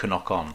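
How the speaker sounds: "knock on" with the silent K voiced; "knock on" as "kuh-nock on"; added K sound. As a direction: imitate the referee saying "knock" as "kuh-nock". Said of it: "he always talks about knock on".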